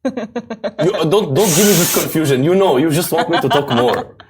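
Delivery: put-on Egyptian-accented English.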